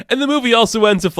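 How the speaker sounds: fast excited high voice; excited rush. Real, not acted. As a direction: hurry to the good part, giddy.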